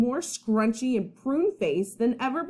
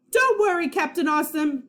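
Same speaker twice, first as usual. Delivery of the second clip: high, loud heroic call; 7 semitones higher, 3 dB louder than usual. Acted. Boy playing superhero to save his friend, loud and heroic. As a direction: announce the rescue like a superhero. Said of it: "his heroic Nacho Cheeseman voice".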